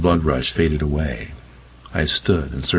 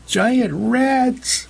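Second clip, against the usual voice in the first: much higher panicked shriek; a woman shrieking in terror. Acted. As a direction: scream it in fright. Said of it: "the woman shrieked".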